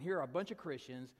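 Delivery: plain reading voice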